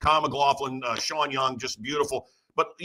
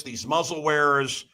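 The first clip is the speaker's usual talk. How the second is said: ordinary talk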